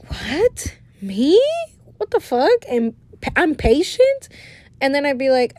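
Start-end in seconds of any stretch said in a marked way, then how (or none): 0.1-4.3 high, rising mock disbelief; acting all shocked. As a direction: play up the astonishment at a compliment. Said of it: "I'd be acting all shocked".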